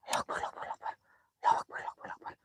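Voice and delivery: whispered prayer, barely voiced; frightened whisper. Real, not acted. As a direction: breathe the takbir out in fear. (bisikan doa ketakutan)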